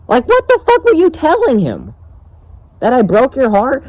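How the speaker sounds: high-pitched exasperated outburst; outraged disbelief. Real, not acted. Vlogger confronting girl about her cousin, outraged disbelief. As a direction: demand it in a high, incredulous voice.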